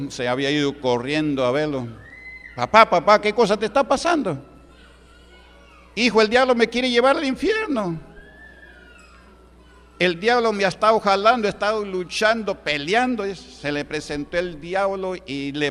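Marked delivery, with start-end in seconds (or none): 2.5-4.5 higher, worried calling out; alarmed son. Retold or imitated. Son running to his screaming father, alarmed higher call. 5.9-13.3 much higher, distressed voice; frightened father. Retold or imitated. Brujo telling his son about the devil, high and frightened.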